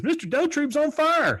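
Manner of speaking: put-on high, loud kid's cry of alarm; mock alarmed yell. Bobby spots his neighbour on fire, high alarmed yell. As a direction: yell it up high like a panicked boy. Retold boy raising the alarm.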